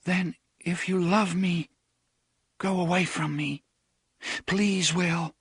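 put-on a girl pleading for restraint; pleading young woman. A narrator's reading.

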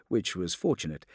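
ordinary delivery, nothing marked

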